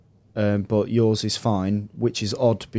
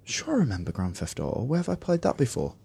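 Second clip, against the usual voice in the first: quiet musing; 8 dB quieter than usual. Retold or imitated. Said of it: "was like".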